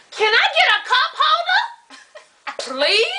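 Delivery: mock polite, high and laughing; high sweet ask. Customer asks the server for a cup holder, high and sweet. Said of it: "asking the server".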